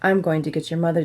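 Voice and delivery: ordinary delivery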